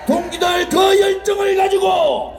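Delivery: high, fervent closing exhortation; rallying cry. Real, not acted. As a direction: whip the crowd up to fight.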